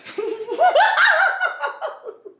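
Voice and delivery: real laughter at the joke; high burst of laughter. Real, not acted. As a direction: laugh out high and loud, then let it fall. Laughs at the quote.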